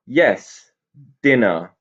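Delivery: cross and loud, snapped at the pixies; loud, irritated goblin reply; crossly. A narrator's reading. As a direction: bite off both words like an offended goblin. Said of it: "said Mister Hay there crossly".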